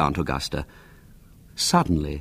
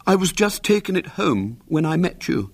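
sorrowful; 10 semitones higher than usual. A narrator's reading. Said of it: "He spoke sorrowfully".

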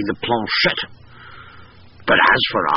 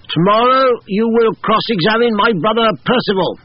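commanding colonel voice; 11 semitones higher than usual. A narrator's reading. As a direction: bark the order like an old officer. Colonel gives orders via medium.

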